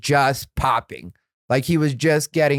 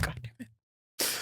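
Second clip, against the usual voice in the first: whispered curse; 16 dB quieter, slower than usual. Retold or imitated. Muttered frustration after brush-off.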